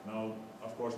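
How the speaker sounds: ordinary talk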